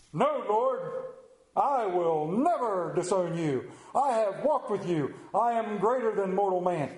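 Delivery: hammed-up heroic disciple; grandiose, puffed-up vow; emphatic boastful Peter. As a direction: proclaim it like a caped superhero, stretching the key words. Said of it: "the most emphatic, powerful, passionate of the disciples".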